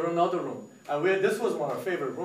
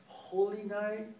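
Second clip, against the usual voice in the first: slow, higher, questioning; tentative suggestion; 5 semitones higher, 4 dB quieter, much slower than usual. Retold or imitated. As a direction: draw it out like a musing colleague. Retold colleague floats a name.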